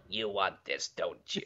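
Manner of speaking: low, soft sinister tease; creepy whisper. Pretend. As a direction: murmur it like a creepy villain. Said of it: "you just hear".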